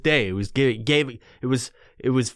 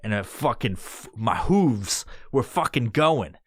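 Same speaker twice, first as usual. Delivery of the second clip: normal talking voice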